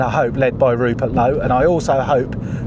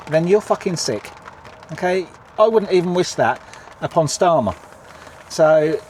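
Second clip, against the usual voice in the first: normal talking voice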